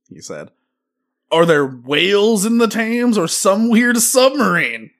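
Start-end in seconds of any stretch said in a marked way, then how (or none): 1.3-4.9 dramatic quote. Retold or imitated. Quoting a Twitter user.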